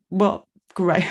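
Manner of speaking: choked up mid-phrase; getting emotional. Real, not acted. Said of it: "sorry, I'm gonna get emotional".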